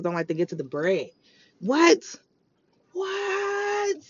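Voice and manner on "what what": outraged disbelief. Real, not acted. Outraged at not chasing money.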